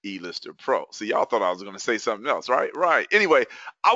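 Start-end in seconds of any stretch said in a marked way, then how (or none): 0.1-0.9 big, high opening like a ring announcer; announcer introduction. Real, not acted. Host opens the webinar introducing himself, showman announcer voice. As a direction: announce the name with showman's flourish.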